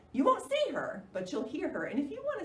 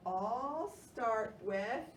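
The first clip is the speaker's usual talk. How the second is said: ordinary delivery, nothing marked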